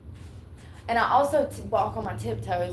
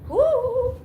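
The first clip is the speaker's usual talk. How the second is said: high, loud happy yell; excited whoop; 8 dB louder than usual. Real, not acted. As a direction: let out a joyful whoop. Whoops in the wind.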